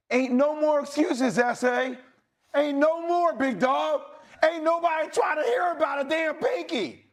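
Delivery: rally the team like a locker-room speech; fired-up rant. Real, not acted. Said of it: "That speech that you just gave".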